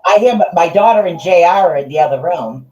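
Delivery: loud, talking over headphones; yelling unawares. Real, not acted. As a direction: speak too loudly without realising it. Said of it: "you don't have to yell".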